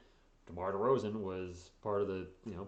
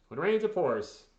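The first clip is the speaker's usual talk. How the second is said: louder and higher, exclaimed; emphatic repeat; 7 semitones higher, 8 dB louder than usual. Real, not acted. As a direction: belt the saying out in exasperation. Same saying repeated for emphasis.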